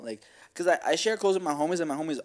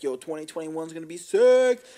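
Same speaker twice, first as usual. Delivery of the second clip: louder, higher imitation of everyone's excitement; over-eager hype voice; mock hype; 5 semitones higher, 8 dB louder than usual. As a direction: mimic the hyped-up crowd, punching the last word. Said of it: "but everyone's like".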